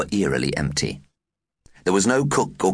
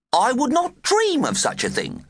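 prim dinner lady voice. Retold or imitated.

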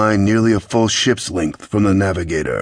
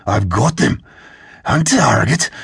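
excited report, rising higher at the end; 4 semitones higher than usual. A narrator's reading. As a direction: call it out with growing excitement. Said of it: "Sarad said with excitement".

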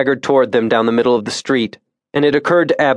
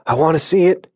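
gasped; 5 semitones higher, faster than usual. A narrator's reading. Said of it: "he gasped".

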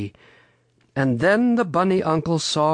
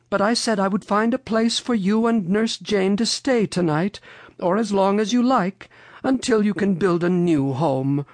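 high-pitched helpful storybook lady; Mother Goose; 7 semitones higher than usual. A narrator's reading. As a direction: offer help in a raised lady voice. Mother Goose offers help.

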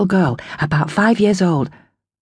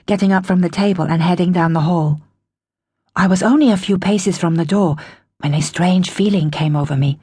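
plain reading voice

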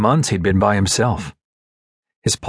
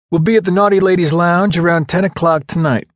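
higher, eager buddy voice; outgoing ladies' man; 7 semitones higher, 4 dB louder than usual. Acted. SEAL tells friend where the team will be, eager raised voice. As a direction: announce the night out to the guys. Voices Dustman announcing evening plans.

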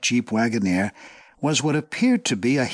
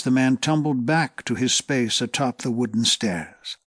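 usual speaking voice

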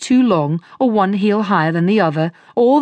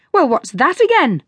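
screaming; 6 semitones higher than usual. A narrator's reading. Master screams at his apprentice over a shoe, mildly. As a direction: snap at the apprentice. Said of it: "screamed he".

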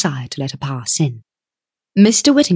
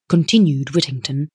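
usual speaking voice

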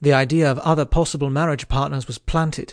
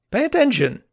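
a much higher teasing knight's voice; joking Lancelot; 11 semitones higher, 3 dB louder than usual. Acted. Lancelot ribbing a daydreaming Tristan, playful and high. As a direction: tease him with a bright jab.

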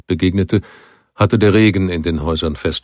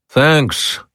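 higher, brief call of thanks; calling back; 8 semitones higher than usual. A narrator's reading. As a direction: toss back a quick thanks. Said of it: "rief er".

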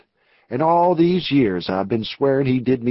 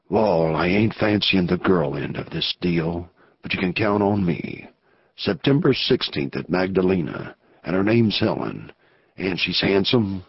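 lower outlaw voice; 6 semitones lower than usual. Acted. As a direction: play the rough outlaw weighing the risk. Narrator voices outlaw Anson.

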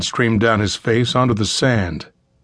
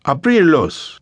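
higher, firm command in Spanish; order in Spanish; 6 semitones higher, slower than usual. A narrator's reading. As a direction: order your man in a Spanish accent. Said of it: "he ordered".

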